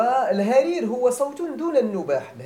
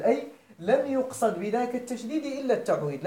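ordinary talk, nothing marked

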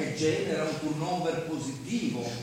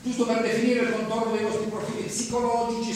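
ordinary delivery

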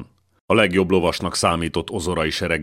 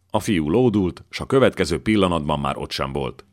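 plain reading voice

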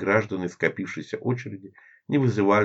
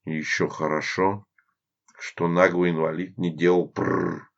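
plain reading voice

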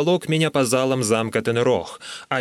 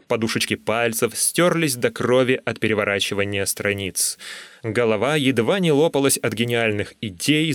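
ordinary delivery

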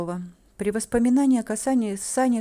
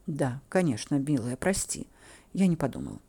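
low, soft reassurance; gentle, lowered voice; soothing apology; 5 semitones lower, 4 dB quieter than usual. A narrator's reading. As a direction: apologise tenderly to a sweetheart.

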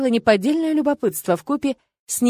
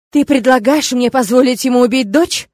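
angry outburst; 3 semitones higher, 7 dB louder than usual. Acted. Father furious at Tirg.

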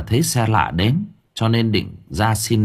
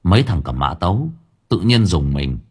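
ordinary delivery, nothing marked

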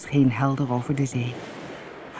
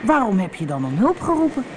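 higher-pitched questioning; lightly voiced surprised boy; puzzled boy; 6 semitones higher than usual. A narrator's reading. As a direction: ask it baffled, pitched up.

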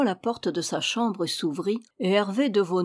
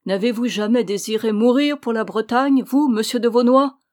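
louder, rising with fervour; sudden enthusiasm; 4 semitones higher, 7 dB louder than usual. A narrator's reading. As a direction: challenge him with patriotic zeal. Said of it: "dont un soudain enthousiasme illumina le visage".

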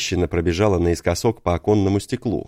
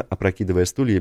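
plain reading voice

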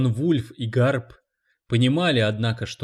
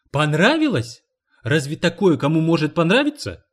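a louder, higher, scornful retort; indignant retort; 4 semitones higher, 6 dB louder than usual. A narrator's reading. Cleaning lady scoffs at the publisher, indignant. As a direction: throw the question back at him.

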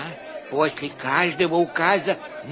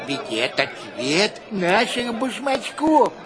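usual speaking voice

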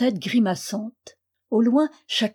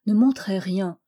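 plain reading voice

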